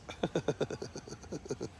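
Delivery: long, breathy run of giggles; soft chuckle. Real, not acted. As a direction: snicker quietly at your own joke. Laughs at own crude joke.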